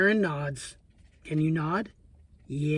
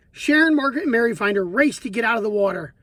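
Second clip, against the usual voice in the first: usual speaking voice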